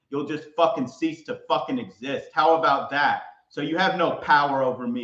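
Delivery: normal talking voice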